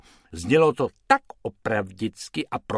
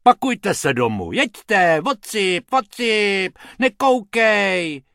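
loud, high, impatient orders; angry shooing; 8 semitones higher, 6 dB louder, slower than usual. A narrator's reading. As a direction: chase them off, stretching the last word. Harmonica man chases the rabbits away.

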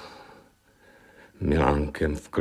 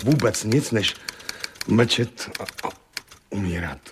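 raised helpless voice sinking into faltering despair; agitated, distraught; 4 semitones higher than usual. Acted. Husband confesses his helplessness to the questioner, distraught. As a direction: pour out the hurt, breaking off into pauses. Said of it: "Uklidněte se, pane Leitne".

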